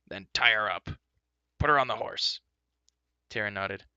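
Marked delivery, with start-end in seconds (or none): 0.1-2.4 louder gruff command; gruff order. Acted. Angry dwarf ordering Terran, gruff and loud.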